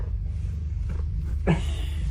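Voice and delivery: soft airy laugh; quiet giggle on the breath; breathy giggle. Real, not acted. Laughing at the cat video.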